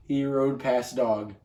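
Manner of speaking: ordinary delivery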